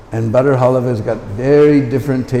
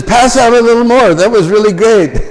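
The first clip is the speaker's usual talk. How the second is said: higher, louder gleeful call for more; 8 semitones higher, 4 dB louder than usual. Retold or imitated. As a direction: shout back happily for seconds. Calls for more halava.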